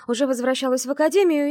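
usual speaking voice

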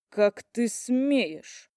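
slow, shaking angry voice; trembling with rage. A narrator's reading. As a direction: voice shaking with anger. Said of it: "голос дрожал от гнева".

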